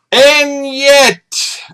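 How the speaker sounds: high, theatrical "And yet," swooping down; hammed-up dramatic "And yet,". Dramatic pause after modest disclaimer.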